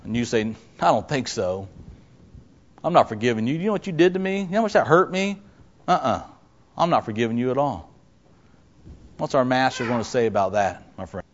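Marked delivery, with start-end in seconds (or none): 0.8-7.9 soft, understated refusal; low-key acted grudge, much softer; quiet refusal. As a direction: turn them down quietly, without raising your voice. Acts out refusing to forgive someone.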